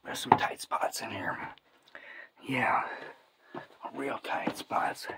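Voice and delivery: strained whisper in a squeeze. Real, not acted. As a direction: whisper while squeezing through. Squeezing through tight spots.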